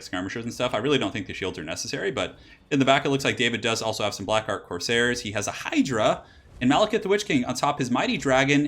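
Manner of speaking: normal talking voice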